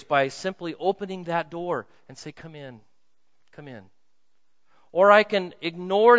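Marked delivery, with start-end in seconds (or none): 2.3-3.8 soft invitation. Acted. Inviting Jesus at the door.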